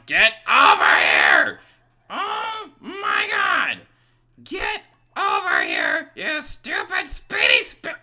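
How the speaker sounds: loud, high, furious yelling; angry shout. Real, not acted. Gamer screaming at a speedy spirit. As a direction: scream at the fleeing ghost.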